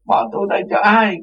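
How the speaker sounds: imitating a woman pleading not to be left; repeated crying plea. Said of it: "Cứ vậy hoài".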